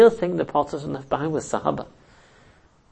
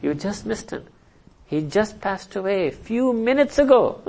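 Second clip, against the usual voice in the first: higher, dramatized delivery of news; emphatic retelling; 5 semitones higher than usual. Acted. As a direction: press the point home with raised pitch. Replays the news, now emphatically.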